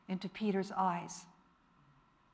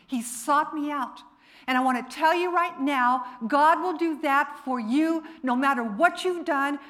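louder, insistent pulpit delivery; impassioned preaching; 4 semitones higher, 6 dB louder than usual. Real, not acted. Preacher promising the congregation God will seek them out, impassioned. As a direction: preach it with rising force.

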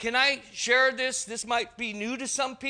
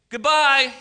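loud, higher mock farewell; mock dismissal; 4 semitones higher, 10 dB louder than usual. Acting out someone tossing the preacher's notes, loud dismissal. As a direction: call out a big dismissive goodbye.

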